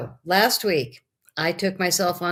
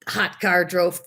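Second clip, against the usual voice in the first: ordinary delivery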